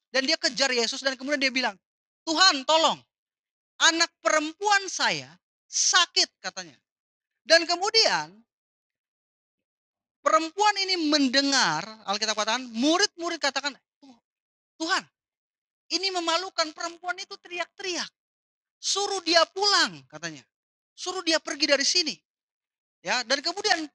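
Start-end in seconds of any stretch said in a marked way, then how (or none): 2.2-6.3 higher, louder, slow begging cry; mother crying out to Jesus to heal her daughter, pleading; desperate plea. Acted. 14.0-20.0 annoyed disciples. Acted. Disciples complain about the woman. 20.9-22.1 irritated demand. Acted. Disciples demand she be sent.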